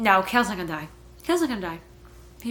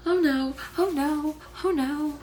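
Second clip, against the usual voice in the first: higher, anxious repeated dread; dismayed oh no; 6 semitones higher than usual. Real, not acted. Reader fearing for Sorscha, anxious higher pleading. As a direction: brace for something awful. Shocked by sword on neck.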